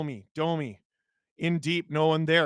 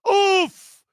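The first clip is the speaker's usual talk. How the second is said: sharp loud wince sound; pained oof; 10 dB louder than usual. Real, not acted. Reacts to high stick.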